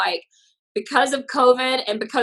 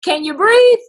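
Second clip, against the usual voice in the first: brisk, dismissive nurse on the phone, pitched higher and louder; offhand hospital receptionist, higher and louder; hospital staff voice; 7 semitones higher, 6 dB louder than usual. Retold or imitated. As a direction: brush the caller off like a busy clerk. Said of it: "the hospitals would tell you".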